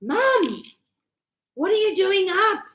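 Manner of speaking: imitated child's startled question; surprised daughter. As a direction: act out a daughter questioning her mother. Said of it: "my daughter goes".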